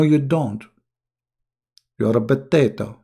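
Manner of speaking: ordinary delivery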